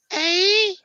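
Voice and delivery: very high, wailing mock Geordie; Geordie as whale song. Shown as a demonstration. Showing friends Geordie is like whale song, wailing accent. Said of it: "The Geordie's like a ... Wales song".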